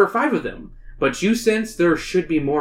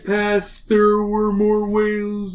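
whale voice; 4 semitones higher, slower than usual. Acted. Narrator voices the lead whale.

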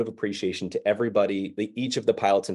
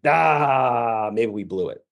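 deflated; much slower than usual. Retold or imitated. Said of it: "I was like".

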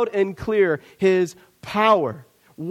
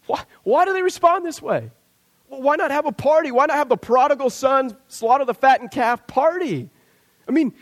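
normal talking voice

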